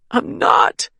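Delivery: high, sobbing denial. A narrator's reading. Wounded Caitlin sobs her denial to Harold. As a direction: sob the denial. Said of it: "Caitlin sobbed".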